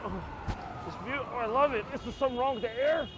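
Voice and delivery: high, loud, choking city dweller; loud, high mock-disgusted walker; hammed-up New Yorker on a stroll. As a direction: gasp at the polluted air. Acts a character on a stroll.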